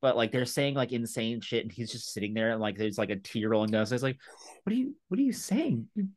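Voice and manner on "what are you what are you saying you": high, fast, incredulous mock panic; imitating Eric Andre freakout. Retelling the Eric Andre scene to a friend, incredulous high squeal. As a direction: squeal it like a guest lost for words. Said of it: "You sound insane".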